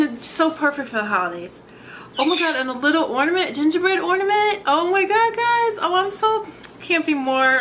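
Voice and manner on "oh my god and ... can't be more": much higher, louder and quicker, rising with delight; gushing excitement. Real, not acted. Finding a gingerbread ornament in the package, higher excited gushing. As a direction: squeal over each new find.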